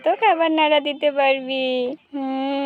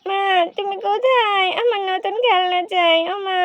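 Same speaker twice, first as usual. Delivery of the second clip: put-on a slightly higher, whimpering little girl; little girl half crying to her mother; whiny crying child; 4 semitones higher than usual. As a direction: whine and sob for mum and a new toy. Child whining for new toy.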